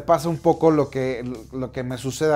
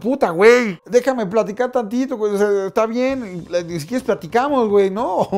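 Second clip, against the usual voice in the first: exasperated; 6 semitones higher, 4 dB louder than usual. Real, not acted. Exasperated at demanding fan.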